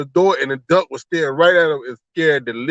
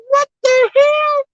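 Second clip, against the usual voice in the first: very high, slow, drawn-out shocked cry; shocked what the hell; 5 dB louder, slower than usual. Pretend. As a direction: stretch it out in disbelief, way up high. Imagined reaction, lead-in "I would be like.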